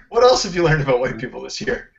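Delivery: ordinary talk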